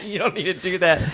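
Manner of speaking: laughing echo. Real, not acted. Host repeating his co-host's line, laughing.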